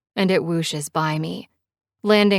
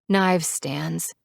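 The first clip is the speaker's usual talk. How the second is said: ordinary delivery, nothing marked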